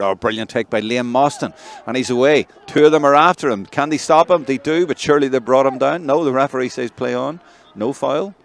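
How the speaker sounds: loud, high-pitched urgent commentary; excited play-by-play. Real, not acted. Commentator follows a player clean through, excited shouting. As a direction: shout the breakaway as it unfolds. Calls a breakaway live.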